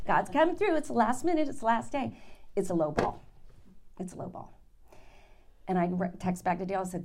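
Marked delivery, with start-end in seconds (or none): 0.1-2.2 high, bright burst of triumph; excited joy. Retold or imitated. Retelling her thrill at the last-minute offer, excited. 4.0-4.6 breathy whisper of disappointment; whispered letdown. Retold or imitated. Telling the congregation the offer disappointed, whispered. Deflated repeat of the news.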